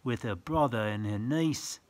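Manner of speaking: sing-song rhyming read-aloud; reading verse to children. Acted. Reading a picture book to a child, sing-song. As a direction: lilt the rhyme for little listeners.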